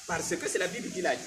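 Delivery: fervent sermon delivery, rising and loud; preaching. Acted. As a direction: preach it to the congregation. Prédication en chaire. (prédication fervente)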